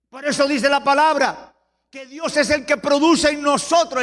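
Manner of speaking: forceful scripture exposition; fervent preaching. Real, not acted. Preacher explains Paul on the flesh, fervent preaching. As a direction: press each verse on the listeners.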